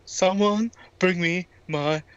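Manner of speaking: very slow, word by word, with gaps; slow talk. Pretend. Winded wrestler demands his sandwich, very slow. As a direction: drag out each word as if short of breath. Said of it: "He's about to talk even slower".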